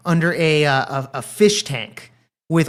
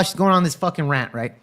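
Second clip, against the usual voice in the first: ordinary talk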